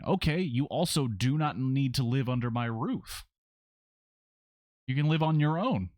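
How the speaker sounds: indignant retort. Real, not acted. Parent answering a curfew complaint, firmly indignant.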